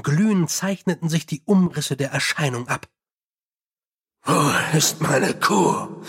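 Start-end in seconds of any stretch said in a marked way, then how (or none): 4.2-6.0 slow, hollow ghostly voice; eerie apparition voice. Acted. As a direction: drag it out like a lost spirit. Apparition speaks in cave.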